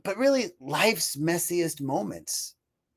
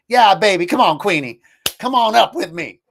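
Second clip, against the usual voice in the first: calling the pet; 7 semitones higher, 8 dB louder than usual. Real, not acted. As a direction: coax the dog up beside you.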